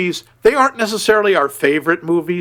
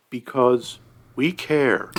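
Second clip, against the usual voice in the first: mock sincere; 4 semitones lower, 6 dB quieter, slower than usual.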